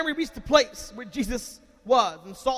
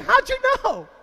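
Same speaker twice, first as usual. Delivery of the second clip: put-on high, small kid's question; child's voice; 13 semitones higher, 5 dB louder than usual. Young preacher answering his parent, high kid voice. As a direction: play the caught kid, surprised. Child caught fake sleeping.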